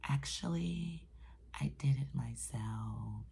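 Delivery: sheepish low whisper; whispered aside. Real, not acted. Admitting to viewers she caused it, in a low whisper. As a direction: confess it under your breath. Confessing her own mistake aside.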